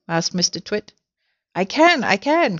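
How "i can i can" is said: high, excited storybook cry. A narrator's reading. Mrs Twit feeling the balloons stretch her, crying out. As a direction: exclaim it in a raised pitch. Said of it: "cried Missus Twit".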